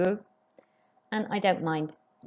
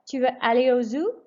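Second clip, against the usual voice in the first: tune climbs on zoo; French question, rising at the end; 5 semitones higher, 4 dB louder than usual. Shown as a demonstration. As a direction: let zoo rise clearly as a question. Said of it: "raise your voice just before the question mark".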